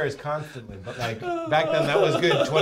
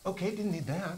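lightly put-on actor impression, higher and softer; dry aside in a higher, quieter voice; Jason Bateman; 4 semitones higher, 7 dB quieter than usual. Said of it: "Why'd I become Jason Bateman?".

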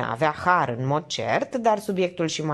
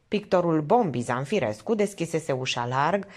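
usual speaking voice

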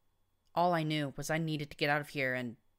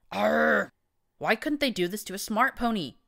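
loud, higher exasperated cry; frustrated outburst; 6 semitones higher, 8 dB louder than usual. Acted. Narrator to the stallion, loud exasperated outburst. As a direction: vent at the silent stallion, loud. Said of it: "I insisted".

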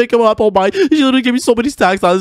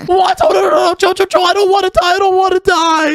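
high panicked whine; panicked pleading; 10 semitones higher than usual. Acted. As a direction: beg for your life, squeaking. Panicked begging for life.

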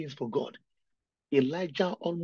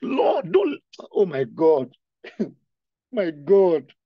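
louder wailing sobs to God; crying out loud; 4 semitones higher, 11 dB louder, slower than usual. Real, not acted. Weeping preacher cries aloud to the Lord. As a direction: let the sobbing overtake the words. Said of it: "The reason why I'm crying".

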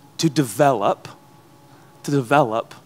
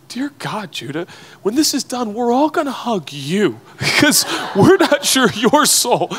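higher, laughing mock worried listener; mock congregation worry; 7 semitones higher, 4 dB louder than usual. Audience imagined fretting over the preacher's soul, laughing. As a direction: tease the crowd's fears with a laugh. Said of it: "some of you are like".

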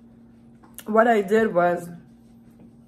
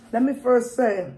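wiping mouth while chewing; muffled by hand and napkin; talking through a napkin; 3 semitones higher than usual. Real, not acted. Seen 1: napkin pressed to mouth while speaking until 2.3, then talks freely.

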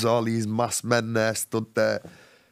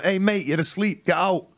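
higher, hammed-up bouncer shout; 5 semitones higher than usual. Bouncer waking the sleeping man in the bar, mock yell. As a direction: throw him out like a bouncer. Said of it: "a bouncer's come on going".

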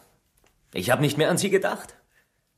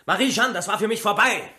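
rising, higher-pitched angry shout; 7 semitones higher than usual. Acted. Suspect shouts his denial at the inspector, pitch climbing. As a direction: shout him down, building to the last word. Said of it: "nur weil Sie schreien".

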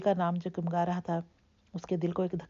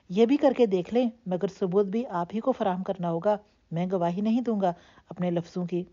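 plain reading voice

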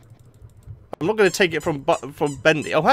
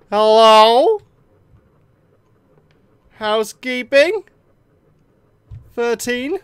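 loud hello into empty halls; calling out; 6 semitones higher, 7 dB louder, slower than usual. Real, not acted. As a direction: call out nervously. Calling out in dark building.

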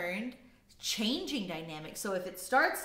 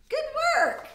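very high, loud cheer; shouted praise; 8 dB louder than usual. Real, not acted. Teacher praising class after loudest demonstration, shouting. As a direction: shout praise at full pitch.

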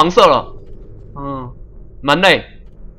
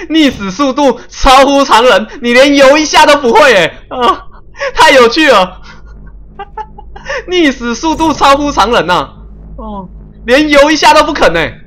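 laughing mockery; 7 semitones higher than usual. Real, not acted. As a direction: laugh at the character drowning so fast. Mocking character drowning instantly.

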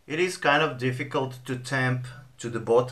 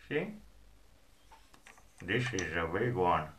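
talking around a pipe; 2 semitones lower, 4 dB quieter than usual. Real, not acted. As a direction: pipe clenched in teeth. Seen 1: Pipe stem held in teeth during "Anyway", removed near 3.1s.

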